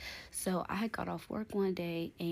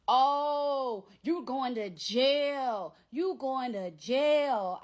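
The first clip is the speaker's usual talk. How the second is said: slow, louder taunting threat in his voice; mocking the ex; 6 semitones higher, 6 dB louder, much slower than usual. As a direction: drag it out like he's gloating. Ex threatening her on the phone.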